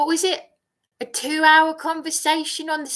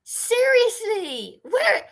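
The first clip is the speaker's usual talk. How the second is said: exasperated plea; 10 semitones higher than usual. Real, not acted.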